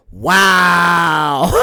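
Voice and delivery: loud, drawn-out indignant wow; mock outrage. [name] answers her accusing brother, hammed-up outrage. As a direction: stretch it out in theatrical offence.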